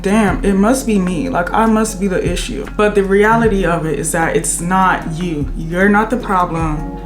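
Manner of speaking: usual speaking voice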